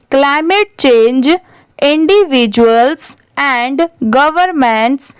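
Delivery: very slow read-aloud, phrase by phrase with pauses; slow model reading. Shown as a demonstration. Teacher reading the paragraph for repeat-after-me, very slow and measured. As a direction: draw each phrase out for learners to repeat. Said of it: "आप भी मेरे बाद repeat करते चलिए".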